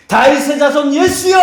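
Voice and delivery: high, ringing cry to Jesus. Retold or imitated. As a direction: call out desperately across a crowd. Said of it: "라고 하면서 길을 그가 만들기 시작합니다".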